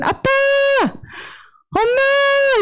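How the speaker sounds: put-on high, drawn-out child's call; model call for help. Shown as a demonstration. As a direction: show kids how to call for parents. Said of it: "이렇게 부르세요".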